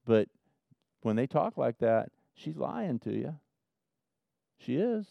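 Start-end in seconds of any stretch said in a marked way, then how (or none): 2.3-5.1 soft, apologetic hard truth; gentle. Real, not acted. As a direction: say it much softer, breaking it gently. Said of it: "I try to say it gently".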